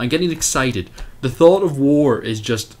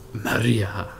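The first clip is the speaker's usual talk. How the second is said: low, drawn-out comic Maria; mock dramatic movie-trailer voice; Pablo Francisco impression; 4 semitones lower, 4 dB quieter than usual. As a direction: say her name like a comedian's bit. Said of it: "I was watching Pablo Francisco last night".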